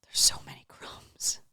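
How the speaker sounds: soft whispered aside about crumbs; whispered complaint. Real, not acted. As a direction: breathe it as a whisper.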